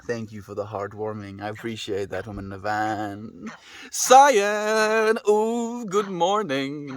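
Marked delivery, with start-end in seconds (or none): 3.9-7.0 excited greeting. Real, not acted. Greeting viewer loudly on stream.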